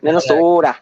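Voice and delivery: "Minnesota" with a long open O and a flapped "soda" ending; mock Minnesotan "Minnesota"; Minnesota accent. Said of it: "That's the way I feel about".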